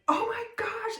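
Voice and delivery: high, startled gasp of oh my gosh; sudden panic as the restraint drops; squeaky alarmed exclamation; panicked oh my gosh. Retold or imitated. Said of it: "And I was like".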